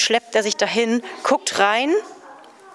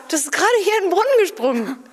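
much higher and faster, a squeaky voice; squeaky quick reply; 10 semitones higher, faster than usual. Acted. Desert man answers the stranger in a squeaky voice. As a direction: rattle it off in a squeaky voice. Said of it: "Dann sagt er".

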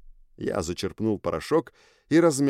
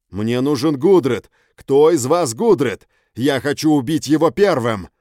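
loud demand; 5 semitones higher, 9 dB louder than usual. Acted. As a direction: shout the demand at the gang. Hero demands Gudred, beaten.

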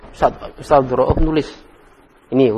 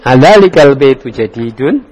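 "jadi dun" lifted into a question at the end; loud start, questioning rise on "dun"; questioning intonation; 5 dB louder than usual. Shown as a demonstration. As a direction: model it as a question, rising sharply on "dun". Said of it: "intonasinya bertanya".

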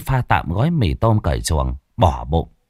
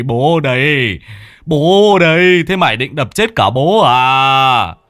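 high, loud, wailing pleading; pleading old father; 4 semitones higher, 5 dB louder than usual. A narrator's reading. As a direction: beg the son to stop. Father begs furious son. (giọng ông già cao, to, van xin)

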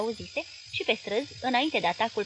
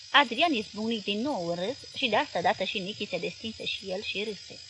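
plain reading voice

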